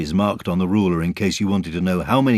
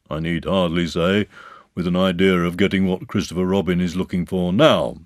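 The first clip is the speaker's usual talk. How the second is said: pompous, self-important donkey orator; Eeyore; 4 dB louder than usual. Acted. Eeyore making his party speech to friends, pompous. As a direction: hold forth with weighty emphasis.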